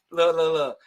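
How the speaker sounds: usual speaking voice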